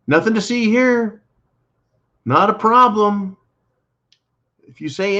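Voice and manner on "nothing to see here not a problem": mock reassurance. Mocking officials.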